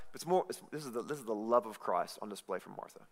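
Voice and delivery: ordinary talk, nothing marked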